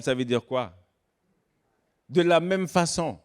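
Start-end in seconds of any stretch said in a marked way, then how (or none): none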